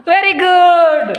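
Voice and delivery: high, drawn-out delighted praise; excited praise. Real, not acted. Teacher cheering a pupil, drawn-out excited praise. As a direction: stretch it out with glee.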